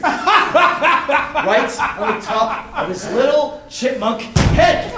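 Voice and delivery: can't stop laughing as he tells it. Real, not acted. Laughing while telling embarrassing story.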